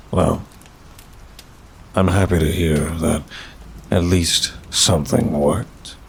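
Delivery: usual speaking voice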